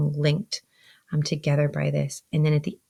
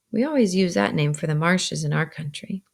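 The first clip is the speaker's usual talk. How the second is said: ordinary delivery, nothing marked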